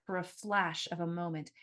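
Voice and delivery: ordinary delivery, nothing marked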